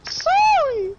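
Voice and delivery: squeaky mock apology.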